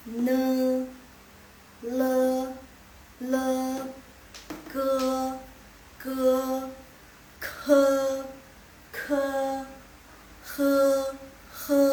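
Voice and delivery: each syllable read out clearly and loud; syllable drill. Shown as a demonstration. Teacher modelling Mandarin syllables for students, loud and clear. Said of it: "Ini bacanya ne".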